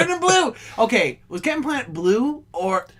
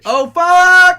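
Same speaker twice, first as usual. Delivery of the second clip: high, very loud outburst; mock excited yell; 12 semitones higher, 10 dB louder than usual. Co-host hears of another TV pitch, mock excited yell. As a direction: shout it high, stretching the last word.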